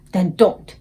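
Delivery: the BFG hushing Sophie sharply. Acted. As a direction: cut her off. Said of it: "answered sharply".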